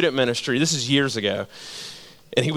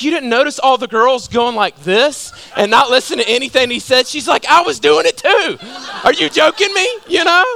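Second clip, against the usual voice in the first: high, louder indignant mom voice; mock indignant mother, pitched way up; outraged mom; 8 semitones higher, 4 dB louder than usual. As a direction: squeal it like a scandalised church mom.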